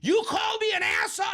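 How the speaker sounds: mock mom shouting high and loud; indignant shout. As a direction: hurl it back, offended. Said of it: "And she's like".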